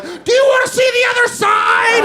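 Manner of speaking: very high-pitched hollered question; hammed-up, high theatrical yell; mock showman shout. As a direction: holler it like an over-the-top stage act. Mock ghost-comedy host bursting on stage.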